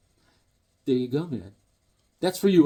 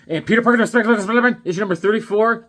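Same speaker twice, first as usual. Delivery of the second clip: announcer voice; 8 semitones higher, 8 dB louder, much faster than usual. Pretend. Reading comic title aloud.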